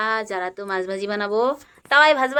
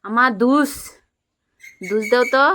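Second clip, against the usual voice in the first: imitating her toddler; 4 semitones higher, 7 dB louder than usual. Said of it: "আমি রান্না করলে যারা বলে".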